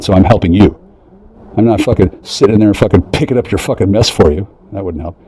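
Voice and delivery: ordinary delivery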